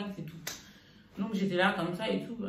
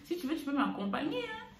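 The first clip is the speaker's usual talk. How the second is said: lightly raised mom voice; a hint of her mother's voice, higher; imitating mother; 6 semitones higher than usual. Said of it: "d'imiter ma mère, comment elle parle".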